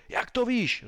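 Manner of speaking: high-pitched outburst of a shout; roaring. A narrator's reading. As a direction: roar the question at the suspect. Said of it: "zařval agent X tři".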